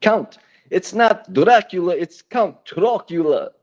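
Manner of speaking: hammed-up vampire count accent; theatrical Transylvanian accent; Count Dracula. Shown as a demonstration. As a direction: correct them grandly in a vampire accent. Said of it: "has to talk like this".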